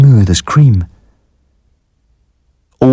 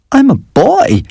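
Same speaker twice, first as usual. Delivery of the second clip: very high-pitched appalled protest; appalled croak. A narrator's reading. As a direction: blurt it out, pitch shooting up. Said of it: "I croaked, appalled".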